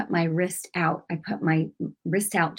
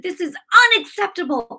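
mad; 10 semitones higher, 5 dB louder than usual. Retold or imitated. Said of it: "I was mad".

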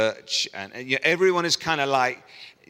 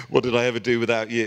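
deadpan sarcastic; mock being impressed, bone-dry; dry sarcasm. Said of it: "God's like".

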